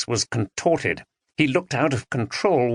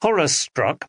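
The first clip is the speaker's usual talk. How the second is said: plain reading voice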